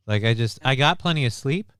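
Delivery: normal talking voice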